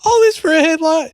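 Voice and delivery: high, disbelieving all this for a headlight; incredulous. Retold or imitated. Retelling the stop to a friend, high stunned disbelief.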